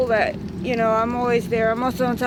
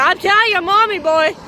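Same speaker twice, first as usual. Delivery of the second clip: louder taunting threat; mock threat; 5 semitones higher, 5 dB louder than usual. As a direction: taunt the kid with it. Threatening the kid.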